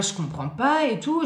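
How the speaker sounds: usual speaking voice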